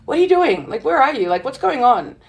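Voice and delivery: ordinary delivery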